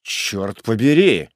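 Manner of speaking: slow, drawn-out cold oath. A narrator's reading. Executioner to begging prisoner, cold oath. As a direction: swear coolly, stretching it out. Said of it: "холодно сказал палач".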